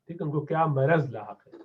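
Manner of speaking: ordinary talk, nothing marked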